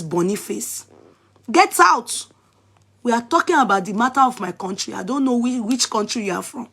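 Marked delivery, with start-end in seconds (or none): 1.5-2.3 sharp high yell of get out; angry shout. Real, not acted. Yelling at a commenter, loud and angry. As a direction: throw them out with a loud bark.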